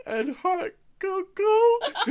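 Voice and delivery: very high, slow squeak breaking into laughter; squeaky kid laughing. Retold or imitated. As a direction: ask for cocoa in a tiny kid voice through giggles. Child asks, laughing.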